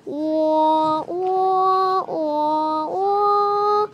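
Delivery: wah wah wah, in a put-on crying wail; a hammed-up baby cry; mock crying. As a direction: fake a little kid's wailing. Child imitating a crying toy.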